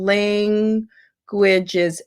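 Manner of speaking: slow "languages", first syllable drawn out; stretched first syllable. Shown as a demonstration. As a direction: hold the LAN in "languages", shorten the rest. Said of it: "you lengthen the syllable of la".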